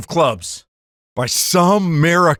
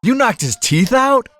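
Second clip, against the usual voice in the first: surprised; 9 semitones higher than usual. Acted. Jack hears teeth knocked out.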